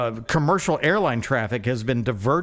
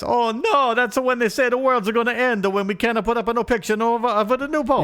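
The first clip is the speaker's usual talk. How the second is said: mock worried leader; 9 semitones higher than usual. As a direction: play a flustered official fussing. Imagined quote, same figure.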